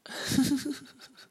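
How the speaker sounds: big audible yawn mid-sentence. Real, not acted. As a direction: let out a long real yawn. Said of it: "A pausa do bocejo".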